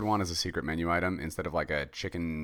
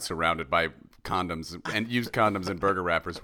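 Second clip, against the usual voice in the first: normal talking voice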